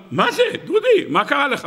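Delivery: high-pitched shocked family questioning; acted alarm, voice leaping up. Family sees Dudi coming home dirty, acted shock.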